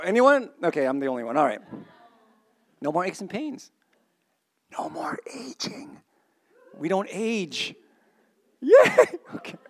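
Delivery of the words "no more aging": high, soft, breathy relief whisper. Real, not acted. Preacher whispering about no more aging, awed whisper. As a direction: whisper it like a secret joy.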